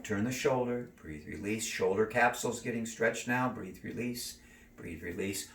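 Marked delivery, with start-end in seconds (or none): 1.0-1.7 soft breathing cue. Shown as a demonstration. 3.5-5.5 soft breathing cue. Shown as a demonstration. Coach guiding a stretch, fading soft breath cues.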